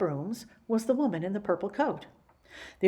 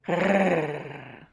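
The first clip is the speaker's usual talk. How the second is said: dog growl; 2 semitones lower, 3 dB louder than usual. Acted. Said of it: "said Martha".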